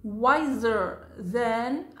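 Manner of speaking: slow teacher demo; slow model reading. Shown as a demonstration. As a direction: stretch it so learners hear each part. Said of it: "إذن wise معناها".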